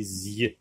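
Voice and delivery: the S and Y of "zy" run together as a z-glide; liaison sound. Shown as a demonstration. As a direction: say "zy" as one joined syllable with a voiced z. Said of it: "on fait la liaison S avec Y".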